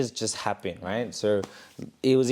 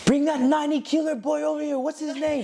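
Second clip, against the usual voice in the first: much higher, put-on voice for the trainer calling out; funny Mister Wong voice. Trainer calling for the big fighter, in a high comic voice. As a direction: mimic Mister Wong shouting across the gym, pitched way up. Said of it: "he said it in such a funny way".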